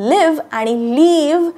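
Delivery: short i in "live", long ee in "leave"; contrasted vowels. Shown as a demonstration. Teacher shows learners the two sounds differ, short "live", long "leave". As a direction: set a brief "live" against a stretched "leave". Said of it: "different उच्चार होतात".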